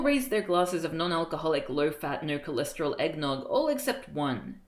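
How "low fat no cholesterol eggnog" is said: plain reading voice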